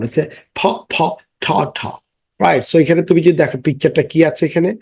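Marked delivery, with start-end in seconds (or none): 0.1-1.8 ফ and ঠ puffed softly, just the bare sound; phonics sounds. Shown as a demonstration. Teacher showing children the p and t sounds, soft puffs. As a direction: breathe out ফ, ফ then ঠ, ঠ. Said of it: "পি এবং টি এর sound".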